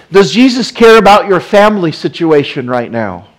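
loud, high rising preacher's question; preaching. Acted. Preacher asking the congregation, loud and high.

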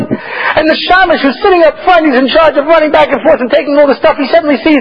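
normal talking voice

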